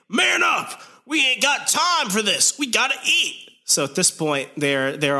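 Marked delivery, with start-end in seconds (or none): 0.1-3.5 high-pitched mock scolding; Luke Cage. Luke Cage telling Pete to man up, high mock exasperation. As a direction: push the voice way up, exasperated and impatient.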